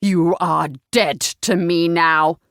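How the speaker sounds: slow, low, menacing father; deep, deliberate fury; disowning. A narrator's reading. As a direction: pronounce it with cold weight. Purple-faced father disowns daughter.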